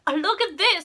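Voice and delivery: high and loud, eager to show something; excited exclaim. Retold or imitated. Friend showing off her house, excited high exclaim. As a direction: call out like you've spotted something great.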